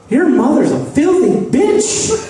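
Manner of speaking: high, piping insult in a put-on voice; mock outburst in a silly high voice; high-pitched stepdad. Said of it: "one of those really high-pitched voices".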